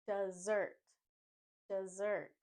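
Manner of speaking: dessert as "duh-ZURT", z sound; weak duh, then strong ZURT in dessert; stress on second syllable. Shown as a demonstration. Teacher modelling dessert for learners, stressed ZURT. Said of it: "The stress is on the second syllable".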